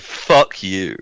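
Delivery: ordinary delivery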